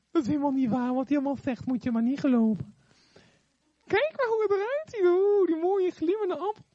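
high, wheedling serpent; snake voice. Acted. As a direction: lure her toward the apple. Snake tempting Eve.

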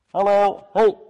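calling out. Acted. Friend knocking at night.

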